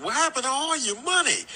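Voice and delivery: mother voice. Retold or imitated. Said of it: "she asked that hard-hitting question".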